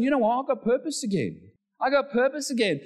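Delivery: high-pitched, fired-up realisation; renewed resolve. Acted. Preacher acting Elijah finding purpose again, fired-up resolve. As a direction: proclaim it as a sudden revival.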